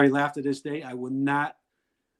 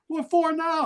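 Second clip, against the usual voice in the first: high, louder exasperated wife voice; mock exasperated wife; 13 semitones higher, 4 dB louder, slower than usual. Wife asked for gas money again, high exasperated mock. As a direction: snap back as the fed-up wife. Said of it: "dialogue with wife".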